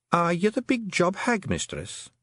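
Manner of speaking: high Scottish pixie voice, formal; a tiny Feegle woman, polite and earnest; small pixie woman. Acted. Fionn the pixie speaks.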